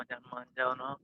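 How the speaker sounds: soft, tender coaxing plea; lovingly coaxing. Shown as a demonstration. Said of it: "मैं प्यार से बोलूंगा".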